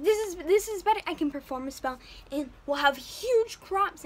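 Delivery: loud, eager insisting; eager defense. Retold or imitated. Jack defending the beans to his mother, eager. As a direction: talk her round with enthusiasm. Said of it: "And Jack's like".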